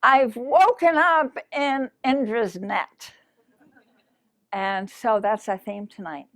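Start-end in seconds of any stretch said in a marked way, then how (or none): 0.0-3.1 loud, high, drawn-out cry of awakening; retold shout. Speaker retelling an awakening to the audience, exultant retold shout. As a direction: call it out slowly, high and loud. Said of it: "And so I s-, shouted".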